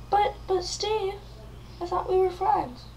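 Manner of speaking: high, wounded mock plea; hurt pleading. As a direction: stammer it like a betrayed friend. Victim pleads with villain.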